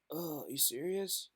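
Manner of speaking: acting out disbelief at rude comments; mock disbelief. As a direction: go from hushed to shrill outrage. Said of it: "It's like".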